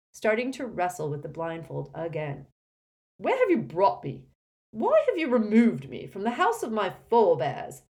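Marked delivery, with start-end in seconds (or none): 3.2-7.8 loud, high, affronted demand; imperious indignation; indignant pompous portrait. Acted. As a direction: demand answers like an outraged grandee.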